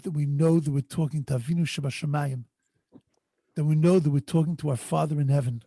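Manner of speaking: usual speaking voice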